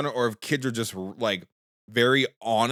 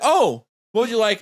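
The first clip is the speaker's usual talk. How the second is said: higher, kindly neighbor offering options; sweet lady; 9 semitones higher, 6 dB louder than usual. Retold or imitated. Neighbor lady asking the boy, sweet and high. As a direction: lilt it up like a friendly lady. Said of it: "she's like".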